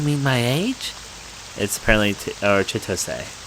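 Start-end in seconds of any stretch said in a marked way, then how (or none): 2.6-3.4 Chitose said chee-TOH-seh, ending on a clear seh; Chitose, three syllables. Shown as a demonstration. Gamer correcting the heroine's name, Chitose with final seh. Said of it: "I'll try to say like Chitose".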